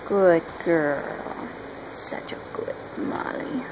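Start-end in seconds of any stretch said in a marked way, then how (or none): 1.9-3.6 soft whispered dog praise. Real, not acted. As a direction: whisper it low and gentle.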